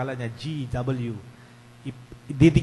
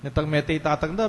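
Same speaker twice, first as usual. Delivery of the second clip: normal talking voice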